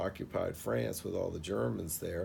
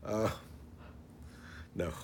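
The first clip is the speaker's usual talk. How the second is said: ordinary talk, nothing marked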